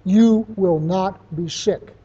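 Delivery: slow, emphatic command; firm, authoritative. Retold or imitated. Speaker commanding himself in the bathroom mirror, firm and authoritative. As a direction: lay down the law, word by word. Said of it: "in a very firm, authoritative voice".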